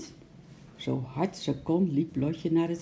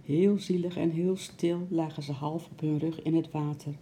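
ordinary delivery, nothing marked

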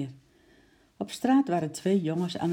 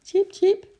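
high, loud nervous squeak; uneasy squirrel chirp; 9 dB louder than usual. A narrator's reading. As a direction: peep anxiously like a worried squirrel. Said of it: "piepte Ekie onrustig".

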